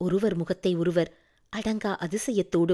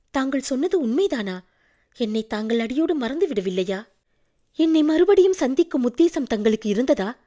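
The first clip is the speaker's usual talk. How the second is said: higher-pitched questions, growing more insistent; eager disbelief; 5 semitones higher, 4 dB louder than usual. A narrator's reading. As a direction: ask with rising, hopeful urgency. Heroine eagerly questions the prince's love. (ஆவல் ததும்பும் கேள்வி)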